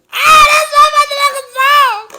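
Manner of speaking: high, very loud fan outburst; excited shout. Real, not acted. Breaking off singing to hail the favorite song, excited shout. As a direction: belt it out in sudden excitement.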